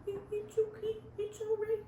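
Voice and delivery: tiny, high, soft coo; exaggerated cooing. Shown as a demonstration. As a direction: coo very softly, like to a baby. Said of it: "Is that what I'm supposed to do?".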